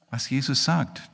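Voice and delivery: normal talking voice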